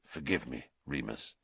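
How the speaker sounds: low, soft, contrite; hushed apology. A narrator's reading. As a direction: ask forgiveness quietly.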